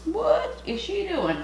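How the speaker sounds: high, slow puzzled onlooker; high bemused voice asking about herself; mock onlooker. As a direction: play a baffled bystander.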